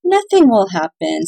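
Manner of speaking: ordinary delivery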